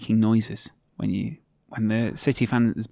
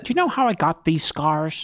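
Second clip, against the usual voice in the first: menacing comic-book villain voice, a touch higher; Joker; 4 semitones higher than usual. Acted. Host answers his cohost in a menacing Joker voice. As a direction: taunt the listener like the Joker. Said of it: "More in a Joker from Batman".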